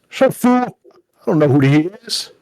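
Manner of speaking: loud, high, impatient elf barking; bossy snap. Acted. Bulky elf shutting up his goblin companion, loud snap. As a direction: cut him off hard.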